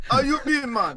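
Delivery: high, playful Jamaican Rasta voice; Rastafarian accent. Pretend. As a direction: put on a laid-back Rasta accent. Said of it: "Are you doing the Rastafarian accent today?".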